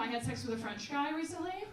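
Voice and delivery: ordinary talk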